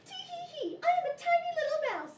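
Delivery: very high, little squeaky giggle and voice; tiny mouse. Acted. Puppeteer voicing the tiny mouse, high-pitched mouse voice. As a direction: play a teeny puppet mouse. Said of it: "along came a tiny little mouse".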